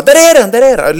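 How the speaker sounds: put-on high, loud happy call of a boy spotting something; excited shout. Son spotting the yoghurt shelf in the shop, excited loud call. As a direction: blurt it out, thrilled to find the yoghurt. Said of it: "blir liksom glad och bara".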